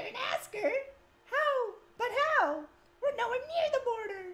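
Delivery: high falsetto, alarmed; worried character in falsetto. Acted. Voicing character in cutscene.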